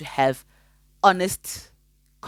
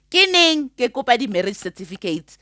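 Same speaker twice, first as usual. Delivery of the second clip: indignant question; 7 semitones higher, 5 dB louder than usual. Real, not acted.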